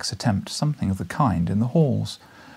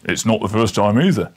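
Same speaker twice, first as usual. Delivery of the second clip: loud stern constable; severe policeman; 6 dB louder than usual. Acted. Policeman rebuking the professor, loud and severe. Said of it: "severely shaking his head".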